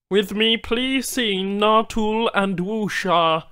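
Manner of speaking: high, odd-timbred alien voice; alien Banu trader. Acted.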